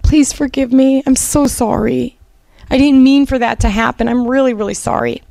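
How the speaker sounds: husband at her hospital bed, imitated begging apology; pleading husband. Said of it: "and he would go".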